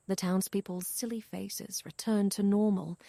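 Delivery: usual speaking voice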